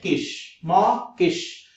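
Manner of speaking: माँ किश slowly, a gap between माँ and किश; slow two-beat माँ, किश; broken into parts. Shown as a demonstration. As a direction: separate माँ from किश each time. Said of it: "words आगे भी तोड़ने हैं".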